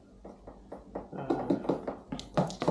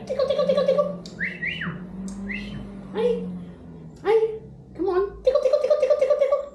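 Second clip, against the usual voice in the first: very high, playful tickle chant with a laugh; man tickling his wrestling parrot, very high baby talk; baby talk to a bird; faster than usual. Acted. Playing tickle games with parrot.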